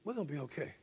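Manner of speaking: quiet, gentle comfort to a friend; hushed reassuring words; soft reassurance. Shown as a demonstration. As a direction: say it softly, like calming him down. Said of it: "And I was able to say".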